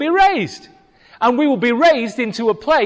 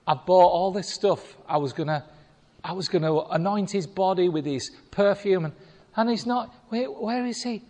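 soft, fading, tearful plea; a grieving woman asking where the body went; Mary crying; 2 semitones lower, 7 dB quieter, faster than usual. Retold or imitated. Mary at the empty tomb, tearful and quiet. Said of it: "Mary cried".

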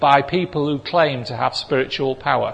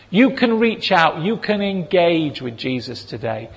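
usual speaking voice

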